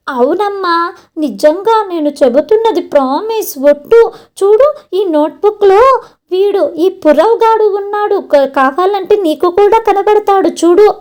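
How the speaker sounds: high, insistent pleading; earnest pleading. Acted. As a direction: swear it's true, begging her to look. Daughter pleading with her mother.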